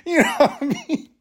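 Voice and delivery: high laughing question. Real, not acted. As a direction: laugh through it.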